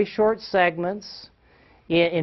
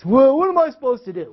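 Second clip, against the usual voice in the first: higher, louder put-on student's plea; helpless student; 8 semitones higher, 5 dB louder than usual. Student in trouble asks teacher for a way out, acted helplessness. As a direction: throw it out as a kid stumped for an answer.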